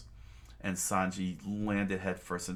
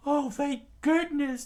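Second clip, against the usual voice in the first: high, loud squeaky relief; relieved crew voice; 9 dB louder than usual. Acted. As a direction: sigh it out in a high cartoon voice. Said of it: "the crew said".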